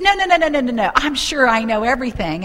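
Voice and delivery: high, loud rush of no's; acted insistence, brushing the doubt away; insistent denial. Woman insists she knows everything.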